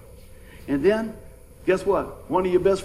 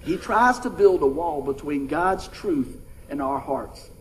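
"tries" hit hard, every word spaced out slowly; slow weighty statement; 5 semitones lower, slower than usual. Shown as a demonstration. Preacher stresses "tries" for the congregation, slow. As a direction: lean on "tries" and let it land slowly. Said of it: "listen to this statement here".

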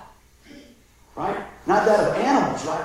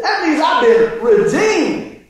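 high, drawn-out joyful cry; exultant testimony; 6 semitones higher, 3 dB louder, much slower than usual. Retold or imitated. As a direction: proclaim it slowly with imitated elation. Acts out man's joyful testimony.

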